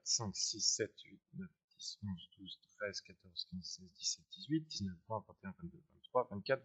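very soft, low murmured count; counting under breath. Real, not acted. Teacher counting periods on the chart, murmured to himself. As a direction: count quietly to yourself, almost inaudible.

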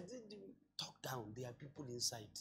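hushed plea. Retold or imitated. Tenant begging the landlord at the door, hushed. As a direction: keep it down so the guests won't hear.

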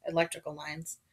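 ordinary delivery

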